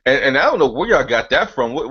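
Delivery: ordinary talk, nothing marked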